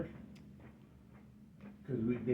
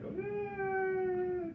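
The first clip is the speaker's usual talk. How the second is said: long, very high imitated wail; drawn-out pretend bawl; mock crying. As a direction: hold a high fake children's cry.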